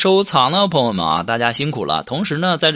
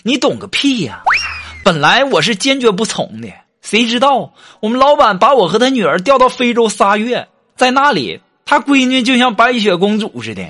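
angry; 8 semitones higher, 3 dB louder than usual. Retold or imitated. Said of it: "当时我哥们儿就怒了".

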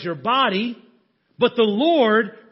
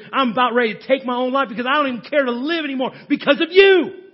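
loud, higher, anguished accusation; angry blame; 4 semitones higher, 5 dB louder than usual. Acted. A hurting listener blaming God, loud and bitter. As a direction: hurl the pain back at God.

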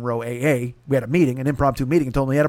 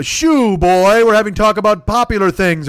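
loud, higher snooty shoo; mock snooty dismissal; 5 semitones higher, 6 dB louder, slower than usual. Rich guys shooing the waiter away, loud mock snooty voice. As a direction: brush the waiter off like a pompous rich guy.

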